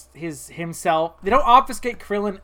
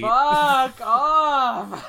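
laughing outburst; 5 semitones higher than usual. Real, not acted. Reacts again, laughing.

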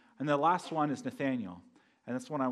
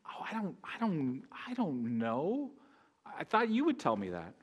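stumped reply; 2 semitones higher than usual. Acted. Stumped by a spiritual director's question, hesitant and baffled. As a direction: stall and stretch it out, at a loss. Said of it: "I never know how to answer it".

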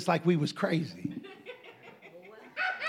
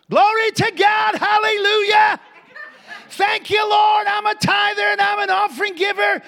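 joyful shouting praise; 12 semitones higher, 7 dB louder than usual. Retold or imitated. Preacher retells praising God in the store, loud shout. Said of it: "I start shouting that".

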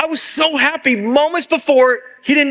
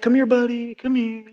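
coaxing; 4 dB quieter than usual. Retold or imitated. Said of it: "So I'm like".

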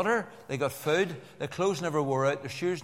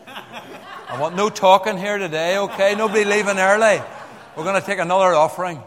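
mock stern warning; 5 semitones higher, 11 dB louder than usual. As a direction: play the strict boss joking to the crowd. Mock threat joke to congregation.

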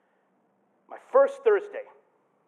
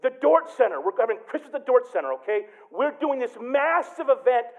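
higher, louder pulpit voice; preaching at full force; 8 semitones higher, 7 dB louder than usual. Acted. A pastor preaching to his church, with full force. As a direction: drive the point home to the congregation.

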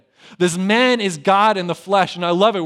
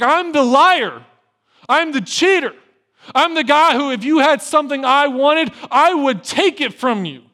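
high and loud, some words slowed; anguished confession; 9 semitones higher, 7 dB louder than usual. Acted. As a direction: pour out the shame at full voice. Jacob confessing his failures.

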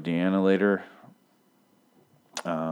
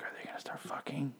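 breathy stage whisper, high and hushed; gossipy whisper; 8 semitones higher, 13 dB quieter than usual. Retold or imitated. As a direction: whisper it like a scandalous secret. Wondering aloud about characters hooking up.

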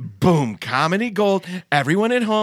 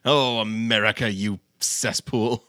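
low disdainful mock; 6 semitones lower, slower than usual. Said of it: "he's like".

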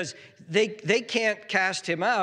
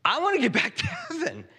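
high-pitched exasperated outburst, sustained; preacher acting out an exasperated, longing rant; holy frustration; 10 semitones higher than usual. Jesus wanting back to heaven from faithless people, frustrated. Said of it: "there's a holy frustration here".

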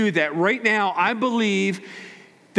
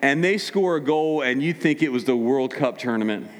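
normal talking voice

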